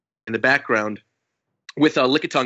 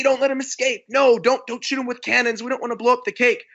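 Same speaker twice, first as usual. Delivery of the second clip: high, urgent mock orders; panicked henchmen; 8 semitones higher than usual. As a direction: voice frantic underlings in a retelling, pitched up. Underlings urgently shouting orders in retelling.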